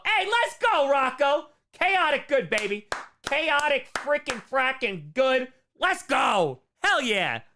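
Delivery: high, loud hype shout; excited cheer. Real, not acted. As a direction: cheer the donor on with full hype. Cheering a viewer's donation.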